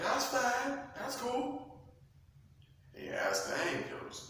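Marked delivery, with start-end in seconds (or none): 0.0-1.8 high, offhand brush-off; dismissive Lucifer. Acted. Lucifer shrugging off God's warning, high and offhand.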